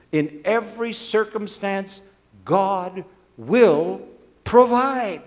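slow, loud, high proclamation; slow loud pulpit declaration; emphatic declaration. Acted. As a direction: drive each word home, slowly. Preacher's climactic declaration.

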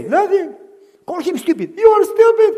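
put-on high, loud boyish protest; puzzled child. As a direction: object like a confused little boy. Child at the grave.

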